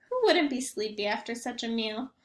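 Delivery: usual speaking voice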